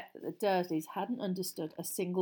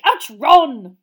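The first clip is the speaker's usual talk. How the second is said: loud, higher-pitched protest; pained yelp; 7 semitones higher, 21 dB louder than usual. Acted. Father jostled by Ron in fireplace, pained yelp. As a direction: yelp at being bumped.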